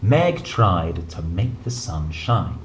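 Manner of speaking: plain reading voice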